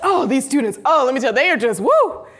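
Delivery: mock excited rave; gushing. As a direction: rave about the students with a woo. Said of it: "We're like".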